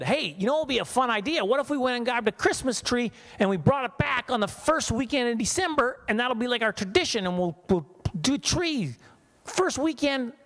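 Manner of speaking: high, eager imitation of his wife; mock wife pitch. Comedian imitating his wife pitching a tree tradition, high and eager. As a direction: pitch it up like an excited newlywed wife. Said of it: "So my wife said".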